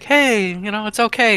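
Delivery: calm her down gently. Acted.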